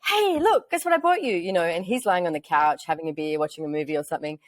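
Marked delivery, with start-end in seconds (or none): 0.1-1.4 high-pitched, eager re-enacted announcement; excited. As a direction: act out bursting in with a surprise. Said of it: "I was really excited".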